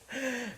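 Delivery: short high-pitched laugh; a quick laugh at himself. Real, not acted. Trader laughing at his own desperate prayer, real laugh. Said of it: "I don't know why I'm laughing".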